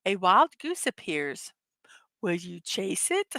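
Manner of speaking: low, softening dungeon master narration; low, suspenseful game master. Acted. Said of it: "Dungeons and Dragons be like".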